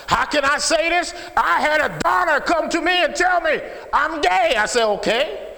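high, loud pulpit shout; impassioned shouted testimony; full-force preaching. Real, not acted. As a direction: cry it out to the whole congregation.

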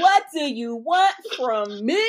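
slow, drawn-out pleading [name] voice. Retold or imitated. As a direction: stretch it out slowly, pleading like [name]. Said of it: "in my [name] voice".

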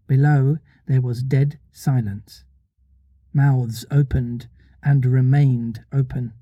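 measured, unhurried storybook reading; story narration. A narrator's reading. Reader telling listeners of Peter staying behind, measured story narration. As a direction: read it aloud with deliberate pauses.

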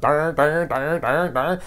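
high, loud dopey babble; dumb baby voice. Retold or imitated. Host imagines the couple's baby, high loud dopey babble.

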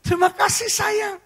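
high, teary squeal of thanks; hammed-up happy crying; mock tearful wife. Wife thanking husband for gift, mock weepy high squeal. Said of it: "Dia nangis".

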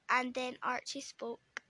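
ordinary delivery, nothing marked

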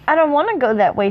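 a touch of timid pleading; mildly worried little hobbit; quivering reluctance. A narrator's reading. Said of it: "said Frodo quiveringly".

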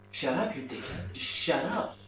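usual speaking voice

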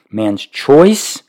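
normal talking voice

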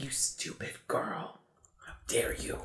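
hushed, slow, scornful whisper; whispered scolding. Retold or imitated. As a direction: hiss it under your breath. Mock scolding Marnie in movie.

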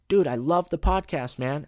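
loud, high-pitched gushing; enthusiastic fan. Retold or imitated. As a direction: shout praise to someone you recognise. Excited fan shouting from car.

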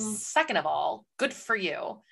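low, soft, slow and dry; deadpan dismissal. Retold or imitated. Reader answering the scaly wolf description, deadpan dismissal. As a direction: count off objections with weary sarcasm.

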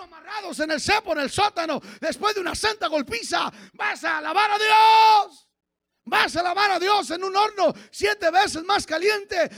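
loud, rising sermon delivery; fiery pulpit preaching; full-force preaching. Acted. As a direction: preach it hard to the congregation.

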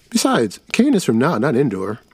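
put-on a know-it-all kid; boy voice. Another kid objects.

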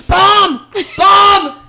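yelled high and very loud in mock panic; mock panicked shout. Friend imitating the nervous flyer on a bumpy flight, panicked shouting. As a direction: shriek it like every bump is a bomb. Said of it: "she's, like, freaking out".

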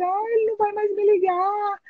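high, tearful whimper; retold crying. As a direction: imitate her own past sobbing complaint. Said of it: "eu comecei a chorar". (choro imitado, agudo)

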